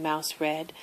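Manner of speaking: plain reading voice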